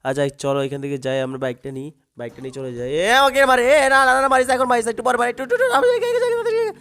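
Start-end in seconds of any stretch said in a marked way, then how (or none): 3.2-6.7 high, loud panicked pleading; panicked squeal. Real, not acted. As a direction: beg the enemy not to shoot. Panicked pleading while being shot. (গুলি খেয়ে আতঙ্কে উঁচু গলায় কাকুতি)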